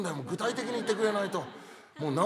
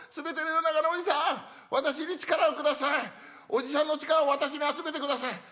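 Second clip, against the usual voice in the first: higher, louder, solemn hammed-up invocation; mock prayer; 8 semitones higher, 3 dB louder than usual. Comic begs all the world's uncles for power: mock solemn. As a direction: call on every uncle like a hero summoning power.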